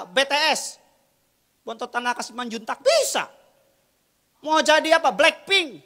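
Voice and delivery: high, loud sermon shout; loud, high-pitched rhetorical preaching; full-force preaching. Real, not acted. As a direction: drive the point home to the congregation.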